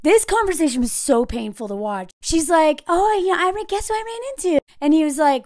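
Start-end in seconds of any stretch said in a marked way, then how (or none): 2.9-4.6 high, hurried imitation; put-on light, quick chatter; mock breezy wife. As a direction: pitch it up, playing casual. Wife acted making breezy small talk.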